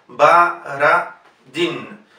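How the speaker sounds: Baradin very slow, unstressed O said as A; Baradin, very slow, Ba-ra-din; very slow syllables. Shown as a demonstration. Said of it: "se transforma directamente en A".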